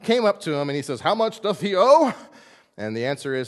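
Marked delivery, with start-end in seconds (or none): 1.0-2.1 king asking. Acted. King asks servant's debt, archaic voice.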